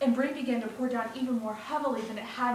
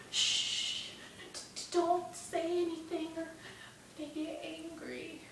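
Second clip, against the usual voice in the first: stammered, higher, soft warning; fearful hushing; 6 semitones higher, 4 dB quieter, slower than usual. Acted. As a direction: shush him, then slow down and fade. Shmuel hushes Bruno near soldiers.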